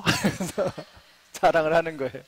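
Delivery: laughing at your own punchline; amused chuckling. Real, not acted. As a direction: chuckle through the words. Laughs at own fly joke. (웃음 섞인 말투)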